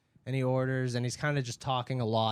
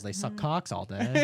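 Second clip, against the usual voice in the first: mock conservative; 4 semitones lower, slower than usual. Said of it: "ironically doing conservative comedy".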